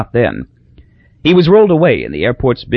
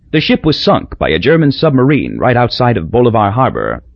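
usual speaking voice

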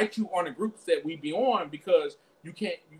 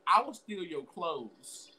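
ordinary delivery

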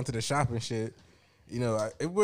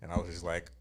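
usual speaking voice

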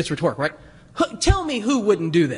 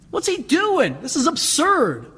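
high-pitched, acted indignation; grumbling disbelief; 8 semitones higher than usual. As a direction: protest like a scandalized onlooker. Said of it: "Of course grumbling's going on".

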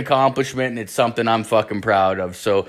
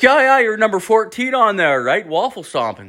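higher-pitched mock dad voice; imitated dad; 9 semitones higher than usual. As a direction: brush it off as a needling dad. Imitating dad's dismissive reply.

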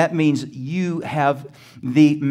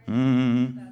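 ordinary delivery

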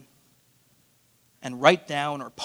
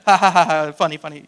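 mock laugh; 8 dB louder than usual. Mocks congregation laughter.

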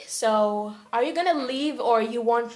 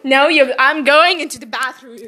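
loud exasperated shout; warning the sister not to follow her; loud protest; 3 semitones higher, 11 dB louder than usual. Acted. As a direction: bark it at the pest.

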